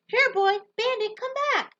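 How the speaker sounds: very high, loud desperate calling; shouting for the dog. Acted. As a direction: call the lost dog across traffic. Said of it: "I'm shouting".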